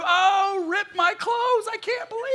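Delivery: high, loud melodramatic wail; hammed-up shock at the top of the voice; mock outrage. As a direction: overplay being scandalized, drawn out and loud. Mock outrage at differing opinion.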